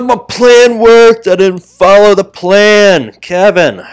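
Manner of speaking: frustrated shouting. Real, not acted. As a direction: yell at yourself for ignoring the plan.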